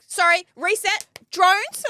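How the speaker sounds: high, loud and drawn-out, like a fed-up bride on her tenth take; mock exasperated. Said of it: "I walk down the aisle and I'm like".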